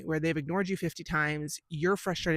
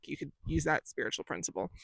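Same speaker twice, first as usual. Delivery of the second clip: normal talking voice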